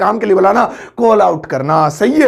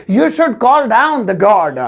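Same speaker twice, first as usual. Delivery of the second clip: slow careful English; much slower than usual. Shown as a demonstration. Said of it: "तो English होगी".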